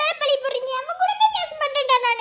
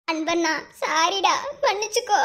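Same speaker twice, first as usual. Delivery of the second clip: lower, slow, sorry plea; pleading apology; 5 semitones lower than usual. Acted. Girl slowly apologises to her brother.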